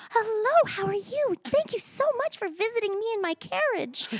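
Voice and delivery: very high, put-on princess greeting; high, sugary storybook princess. Shown as a demonstration. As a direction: play a park Cinderella greeting little fans. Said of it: "I would talk to them like this".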